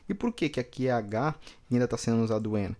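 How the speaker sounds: usual speaking voice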